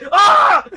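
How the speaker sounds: high, loud cry of alarm; sudden scream of fright; startled yell. Pretend. Dad grabbed from below, loud startled yell.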